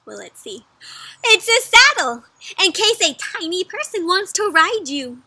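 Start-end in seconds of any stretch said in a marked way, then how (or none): 1.2-5.2 high, loud, proud announcement; excited reveal. A narrator's reading. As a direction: present your gift with great fanfare. Horse presents a saddle gift.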